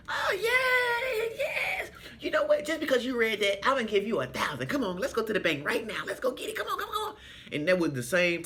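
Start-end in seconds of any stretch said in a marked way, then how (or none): 0.1-1.9 high, shrieking joyful scream; ecstatic cry. Retold or imitated. Winner learns the prize, high joyful shriek. Winner hears thirty thousand. 2.2-7.2 high, rushed, laughing urging; excited winner. Retold or imitated. Winner rewarding the helper, high rushing excitement.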